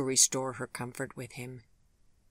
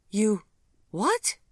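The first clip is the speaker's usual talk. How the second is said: shocked, leaping much higher and louder; surprised; 8 semitones higher, 7 dB louder than usual. A narrator's reading. Gregory hearing she's a grandmother, shocked high cry. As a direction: caught completely off guard. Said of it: "asked Gregory in surprise".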